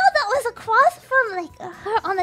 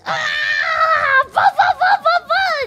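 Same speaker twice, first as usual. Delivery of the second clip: mock brawl noises; 4 semitones higher, 6 dB louder, slower than usual. Describing the brawl.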